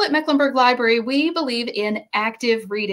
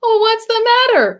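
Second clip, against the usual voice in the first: much higher, louder concerned call; concerned giant; 10 semitones higher, 6 dB louder than usual. Acted. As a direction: call out to the goat in worry. George asks the goat.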